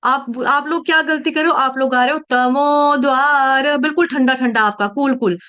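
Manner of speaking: ordinary delivery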